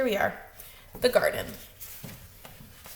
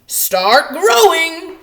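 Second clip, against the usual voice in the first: louder, drawn out, ordering the seeds; loud command; 5 semitones higher, 12 dB louder, much slower than usual. Acted. Toad ordering his seeds to grow, loud slow call. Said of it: "he said loudly".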